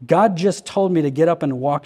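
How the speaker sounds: ordinary delivery, nothing marked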